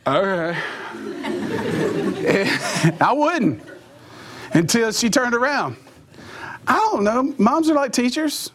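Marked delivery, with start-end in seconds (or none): none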